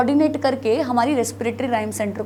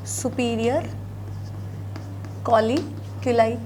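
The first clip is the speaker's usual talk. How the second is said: कॉलिकुलाई drawn out syllable by syllable, much slower; slow repeat for dictation; 6 dB quieter, much slower than usual. Shown as a demonstration. Teacher repeats the term slowly so students can note it down. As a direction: say superior, pause, then stretch out कॉलिकुलाई. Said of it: "इनको बोला जाता है superior".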